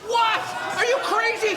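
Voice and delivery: yelling at the ref; outraged. Acted. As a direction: shout in disbelief. Outraged at the referee's call.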